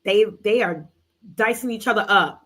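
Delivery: ordinary talk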